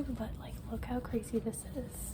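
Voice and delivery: whispering. Real, not acted. Filming inside quiet capsule hotel.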